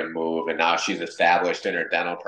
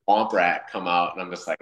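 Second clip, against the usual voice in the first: usual speaking voice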